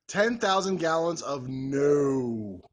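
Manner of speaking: slow, loud, drawn-out refusal; emphatic refusal. Acted. Still refusing the viewer, slow loud emphatic no. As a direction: pour on the refusal, slowly. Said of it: "with an emphatic".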